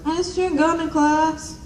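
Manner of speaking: high, slow little mousy voice; mock timid girl. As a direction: play a meek classmate owning up. Mocking a detention classmate.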